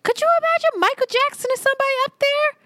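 incredulous. Real, not acted. Incredulous rant about showmanship.

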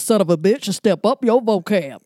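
loud, quick rap-line recital; punchy repeat performance on request; rapper impression. Shown as a demonstration. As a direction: throw it out hard, like the record. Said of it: "say it one more time".

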